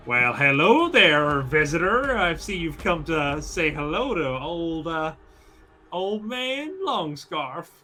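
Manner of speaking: slow, swooping Southern welcome; southern drawl. Acted. Bandit greets the visitor he attacks, slow southern drawl. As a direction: draw out a Southern villain's greeting. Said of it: "The southern drawl".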